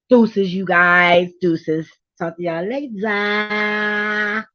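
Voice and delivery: slow, stretched goodbye; sing-song sign-off. Real, not acted. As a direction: drawl the farewell playfully.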